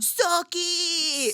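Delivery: Suck it catchphrase. Retold or imitated. Recalling a childhood wrestling taunt, high mock yell. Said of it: "We did that all day".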